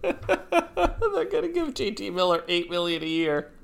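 high laughing disbelief. Real, not acted. Laughing incredulously.

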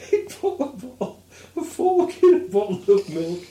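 high, laughing punchline; laughing while talking. Real, not acted. Host retells the punchline to his co-host, laughing high. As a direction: squeeze the words out through laughter.

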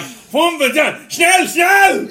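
high, very loud German shouting; mock German screaming. Imitating the player urging teammates in German, screamed impression. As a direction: scream it like a frantic German command. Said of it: "I just realised that was screaming".

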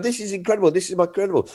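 high, breathless repetition; excited. Retold or imitated. Texting mates about the exit poll, high and gushing. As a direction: gush it to your mates. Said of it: "I'm so excited".

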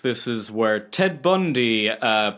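slow, rising tour guide patter; hammed-up bus tour announcer. Mimics tour guide on murder bus.

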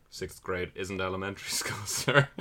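a hint of a smug officer setting her straight; correcting officer. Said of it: "then he's like".

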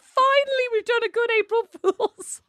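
very high, gleeful squeal through laughter; giggly re-enacted celebration; laughing triumph. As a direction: crow over the prank while cracking up.

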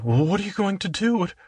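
high, anxious princess; worried princess voice. Acted. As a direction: ask it high like a fretting princess. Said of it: "And Cinderella".

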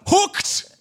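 high, loud "Hooked!"; excited shout. Shown as a demonstration. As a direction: yell "Hooked!" like landing a fish. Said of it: "when you've just caught a fish".

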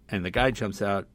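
usual speaking voice

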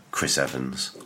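ordinary talk

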